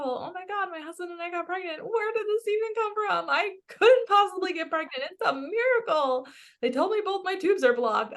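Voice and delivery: high, gushing amazed woman; overjoyed mother. Acted. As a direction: gush like a woman told of a miracle.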